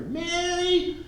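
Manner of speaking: imitated yell to the next room; high, drawn-out call to an assistant; Mike. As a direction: holler orders through the wall, mimicking the boss. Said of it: "he'd rather yell to Mary".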